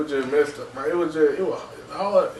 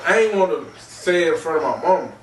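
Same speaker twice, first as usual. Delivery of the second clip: ordinary talk, nothing marked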